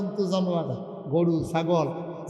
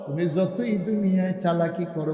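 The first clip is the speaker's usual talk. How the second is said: normal talking voice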